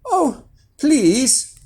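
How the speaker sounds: put-on high, pleading boy; drawn-out wheedling plea; coaxing. A narrator's reading. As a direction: beg sweetly, pitched right up. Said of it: "coaxed Jack".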